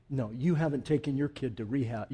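ordinary delivery

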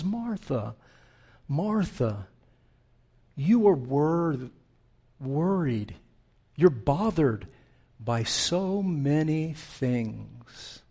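soft, tender address with lingering pauses; gently soothe, without any rebuke. Acted. Jesus answering Martha's complaint, tender. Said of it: "Jesus says to her tenderly".

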